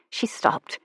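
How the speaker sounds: ordinary delivery, nothing marked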